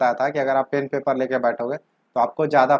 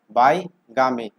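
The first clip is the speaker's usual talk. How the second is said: बाईगामी drawn out slowly and carefully; slow teaching stress; much slower than usual. Shown as a demonstration. Teacher naming the term for students, slow and clear. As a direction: lay out बाईगामी slowly for the class. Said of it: "उसे बोलते हैं".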